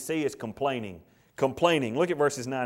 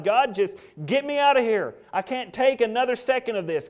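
Elijah pleading; 7 semitones higher, 4 dB louder than usual. Acted. Elijah begging God to escape.

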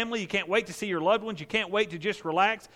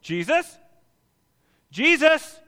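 high, loud calls across the water; yelling from shore; 11 semitones higher, 6 dB louder than usual. Retold or imitated. Man on the shore hailing Jesus, loud high yell. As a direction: shout his name from the shore. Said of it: "somebody yells from the shore".

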